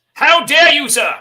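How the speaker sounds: pompous count sputtering at an insult; offended aristocrat. Acted. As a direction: play a huffy count insulted by a nickname. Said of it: "The ultimate slight".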